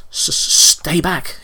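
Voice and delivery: high, loud, stammering warning; frightened cry. A narrator's reading. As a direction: stutter out a scared, defensive cry. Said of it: "he cried".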